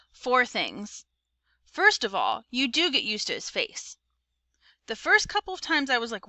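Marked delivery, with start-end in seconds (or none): none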